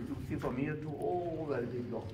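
ordinary delivery

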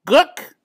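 high, loud bird honk; penguin call. A narrator's reading. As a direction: honk like a penguin about to slide. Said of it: "said Captain Cook".

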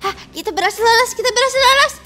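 squeaky frightened urging; breathless little toy voice; high panicky voice. Acted. Captured toys urge escape. (suara cemas melengking)

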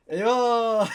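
rueful laugh. Real, not acted. Drawn against Paris, laughs.

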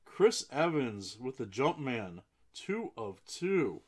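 loud, thrilled card reveal; excited announcement. Real, not acted. Breaker announcing a rare pull, excited. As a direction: call it out, lifting on the number.

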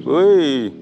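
shouting over wind. Real, not acted. Calling out in strong wind.

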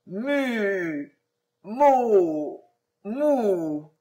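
halting broken syllables; groping for a forgotten word; stumbling on the word. A narrator's reading. The vizir fails to recall the magic word, stammering. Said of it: "sans pouvoir arriver au bout".

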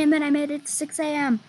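ordinary talk, nothing marked

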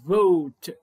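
فوت drawn out slowly on a long o. Shown as a demonstration. Teacher models the long o for pupils, slow. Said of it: "نطقنا ال O long".

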